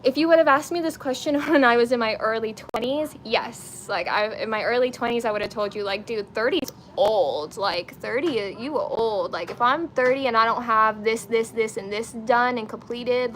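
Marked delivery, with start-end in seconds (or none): none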